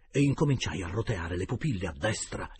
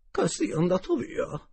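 higher, louder anxious question; worried lady; 12 semitones higher, 7 dB louder than usual. A narrator's reading. Geltrude asking the cook anxiously, high worried voice. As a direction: fret aloud to the cook. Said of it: "chiese al cuoco".

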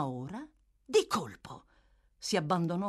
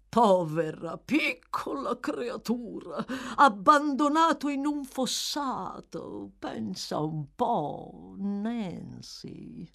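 mock pity; 5 semitones higher, slower than usual. A narrator's reading.

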